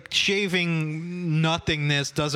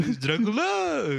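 mock Dracula voice; 7 semitones higher, slower than usual. Joking about Dracula voice.

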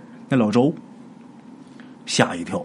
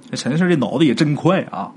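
plain reading voice

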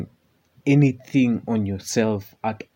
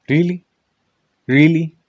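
incredulous; 5 semitones higher, 8 dB louder than usual. Real, not acted. Incredulous retort.

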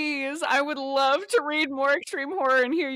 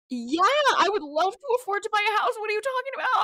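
high, fast, sarcastic and incredulous; mock outrage, pitched high and quick; sarcastic retort; 10 semitones higher, 4 dB louder, much faster than usual. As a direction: fire it back, laughing disbelief. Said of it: "And I'm like".

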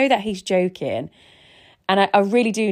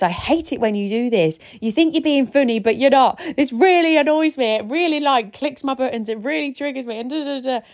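high-pitched exasperated rant, replayed; higher-pitched angry outburst at husband, retold; 7 semitones higher than usual. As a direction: re-enact flying off the handle, pitch up. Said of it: "I just flew off the handle".

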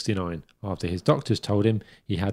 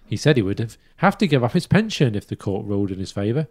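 ordinary delivery

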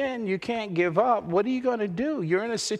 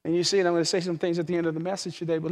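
quiet reflection; 2 semitones lower, 3 dB quieter than usual. Acted. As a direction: draw them in softly. Softer reflective sermon.

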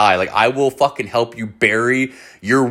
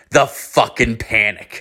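ordinary delivery, nothing marked

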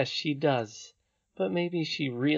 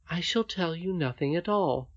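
defiant princess; 6 semitones higher than usual. Acted. Princess answers the angry nurse.

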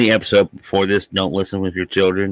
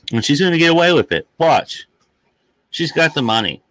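heated outburst; 8 semitones higher, 4 dB louder than usual. Real, not acted. Indignant prediction.

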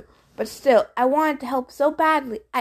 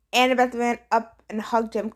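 ordinary delivery

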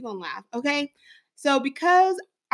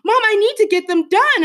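put-on higher, louder kid voice pushing his mom; insistent son; 9 semitones higher, 7 dB louder than usual. Mom imitating son insisting on valentines, higher and louder. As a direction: whine it up like an impatient boy.

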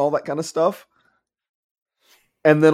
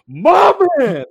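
loud, much higher yell of "My man!"; screamed cheer; 7 dB louder than usual. Retold or imitated. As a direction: belt "My man!" across the theater. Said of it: "When you screamed that".

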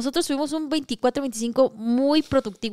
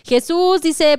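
ordinary delivery

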